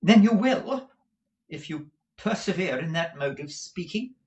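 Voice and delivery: high, loud opening warning; scolding wife. Acted. Wife warns her husband, high and stern.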